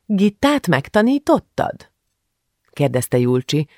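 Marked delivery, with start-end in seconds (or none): 0.1-1.9 put-on high, slow, jealous child's question; lightly voiced suspicious little girl; barely veiled jealousy. A narrator's reading. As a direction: ask with thinly hidden envy. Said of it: "alig leplezett féltékenységgel a hangjában".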